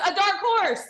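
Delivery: A dark horse shouted high and loud; high excited shout. Shown as a demonstration. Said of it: "Read it, read it with it".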